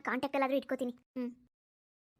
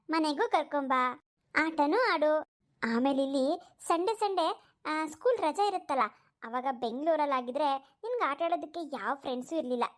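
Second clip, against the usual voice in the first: usual speaking voice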